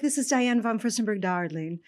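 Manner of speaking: a hint of a grand, accented fashion icon; lightly put-on accent, imitating a chic caller; lightly imitated accent. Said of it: "the accent was so good".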